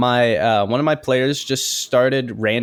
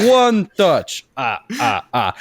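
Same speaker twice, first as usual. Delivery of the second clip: put-on higher Transylvanian vampire accent with a counting laugh; Count von Count voice; 4 semitones higher, slower than usual. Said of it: "were in the count voice".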